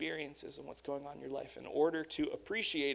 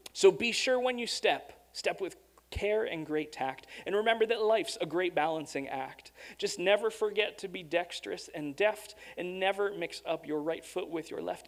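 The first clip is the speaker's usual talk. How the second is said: lifted, emphatic storybook reading; poem read aloud; 4 semitones higher than usual. A narrator's reading. Preacher resumes the Seuss poem after an aside, lifted storybook reading. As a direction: read the verse with a raised, bouncing lilt.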